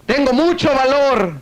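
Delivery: high, drawn-out bold cry; acted shout. Man finding courage in cave, acted shout. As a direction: call it out slowly, pitched high. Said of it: "Y empezó a gritar".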